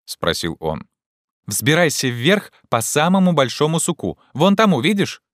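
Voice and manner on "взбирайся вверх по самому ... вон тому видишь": high, raised-voice instruction; shouted command. A narrator's reading. Legrand directs Jupiter up the tree, shouting. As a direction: call it up to a climber. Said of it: "крикнул Легран".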